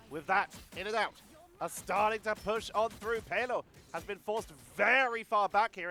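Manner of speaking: ordinary delivery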